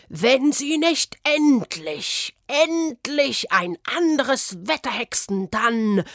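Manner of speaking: high, slow, loud threat; a threatening letter voiced loud and high; menacing threat. A narrator's reading.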